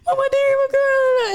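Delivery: high, loud mock bawling; retold crying. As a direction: bawl to mom in a re-enacted meltdown. Retold tearful call to mom.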